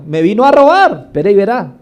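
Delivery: strong man furious at the robber; angry threat. Retold or imitated. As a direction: threaten the thief who broke in. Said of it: "el hombre fuerte se pone a pelear".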